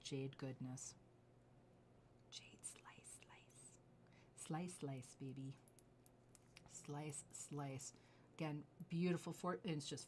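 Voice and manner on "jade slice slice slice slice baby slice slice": whispered sing-song chant of slice; hushed teasing chant; playful whisper. Real, not acted. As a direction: croon the word slice softly.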